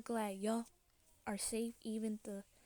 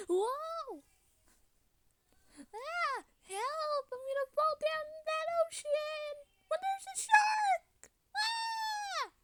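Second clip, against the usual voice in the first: very high, squealing play-acted panic; pretend terrified screaming; 5 dB louder, slower than usual. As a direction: scream for help as if falling. Falling toward a shark, calling help.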